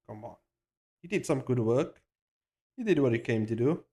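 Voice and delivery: ordinary talk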